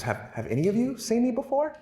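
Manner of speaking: put-on high child voice, pleading; kid's voice. Kid voiced in story.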